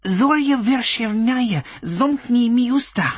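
high, slow witch invocation; slow incantation. Acted. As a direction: chant the spell slowly and high.

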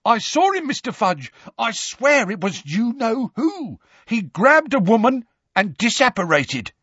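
high-pitched, urgent eyewitness report; agitated witness, pitched high; excited shouting. A narrator's reading. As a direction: insist on it at the top of your range. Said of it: "shouted a scarlet-robed man".

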